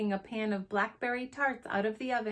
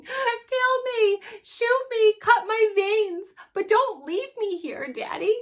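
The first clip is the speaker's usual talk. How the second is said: put-on high, loud wail of a hysterical child; shrieking; 13 semitones higher, 9 dB louder, slower than usual. A narrator's reading. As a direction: shriek like a boy begging not to be abandoned. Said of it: "he hung on, shrieking".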